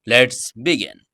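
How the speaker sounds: plain reading voice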